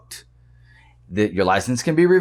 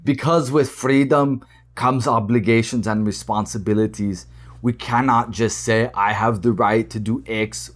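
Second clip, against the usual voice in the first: lower-pitched Austrian accent impression; Arnold Schwarzenegger; 5 semitones lower than usual. Shown as a demonstration. Reading the actor's quote to viewers in a low Austrian accent. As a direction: imitate an Austrian action star, pitched down. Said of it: "I'll do an Arnold accent".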